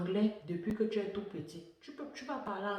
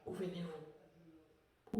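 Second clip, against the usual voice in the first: mock question; 2 semitones lower, 12 dB quieter, much slower than usual. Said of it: "on dit".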